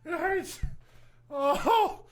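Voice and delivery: aching from laughter, words squeezed out; after laughing hard. Real, not acted. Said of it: "oh, my stomach hurts".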